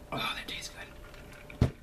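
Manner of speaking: breathy whisper of pleasure after a sip. Real, not acted. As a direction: whisper it as if savoring the drink. Sips drink on camera.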